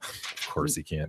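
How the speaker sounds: whispered aside under the breath; breathy unvoiced whisper. Real, not acted. Muttered aside about friend.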